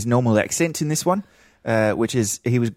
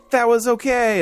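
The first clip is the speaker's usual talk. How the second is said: forced positivity; 13 semitones higher, 3 dB louder than usual. Retold or imitated. Retelling second, less thrilled viewing.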